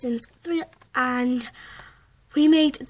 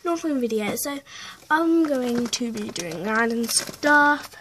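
normal talking voice

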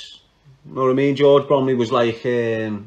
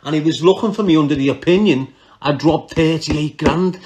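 normal talking voice

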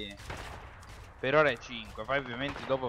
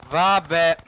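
loud frustrated; 4 semitones higher, 11 dB louder than usual. Real, not acted. Frustrated at low like count.

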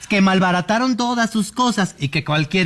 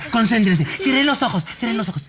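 ordinary talk

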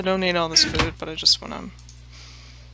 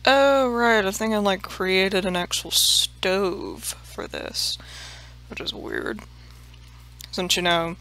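ordinary delivery

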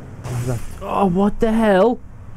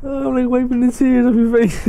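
laughing; 7 semitones higher, faster than usual. Real, not acted. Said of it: "crying with laughter".

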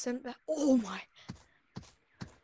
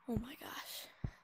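breathless whisper of awe; awed whisper; 12 dB quieter, much slower than usual. Real, not acted. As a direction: hush it out in wonder. Awed by new map.

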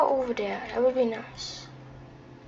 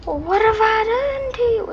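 mock whining; 4 semitones higher than usual. Real, not acted. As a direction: whine at the chaser. Whining at game chaser.

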